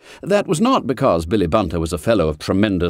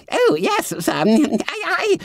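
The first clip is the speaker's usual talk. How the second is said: high squeaky startled stammer; flustered fat schoolboy; slower than usual. A narrator's reading. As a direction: squeal it, caught out. Bunter caught out.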